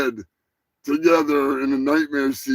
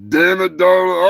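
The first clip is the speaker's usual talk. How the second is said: loud call-out; 6 semitones higher, 8 dB louder than usual. Real, not acted. Calls out the song title.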